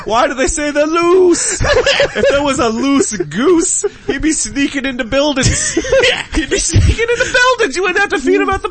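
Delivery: high, slow giggly rant; laughing. Real, not acted. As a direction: squeeze it out through laughter.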